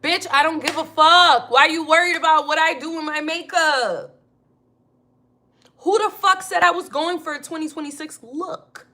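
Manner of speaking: loud, high snappy comeback; loud defiant clapback; loud retort. Pretend. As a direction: snap back at the hater. Retorting to a makeup critic.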